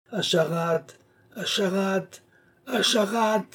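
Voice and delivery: climbing, drawn cry into the distance; piercing cries. A narrator's reading. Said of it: "les cris du vieillard abandonné devenaient de plus en plus perçants".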